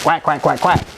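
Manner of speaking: imitating a hypnotized lady's quacking; mock duck quacks. Retelling a hypnosis show, playful duck quacks. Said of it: "This lady gets up, starts".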